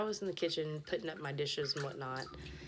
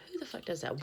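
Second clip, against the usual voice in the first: higher, full-voiced outrage; outraged; 8 semitones higher, faster than usual. Real, not acted. Rant, repeated aloud.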